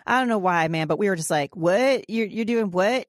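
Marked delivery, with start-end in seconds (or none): 1.6-3.1 louder, higher stunned questioning; imitating a dumbfounded parent; incredulous disbelief. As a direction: react like a parent who can't believe the news. Acts out parents' disbelief at daughter's news.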